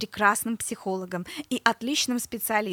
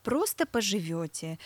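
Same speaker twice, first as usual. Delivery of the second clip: ordinary talk, nothing marked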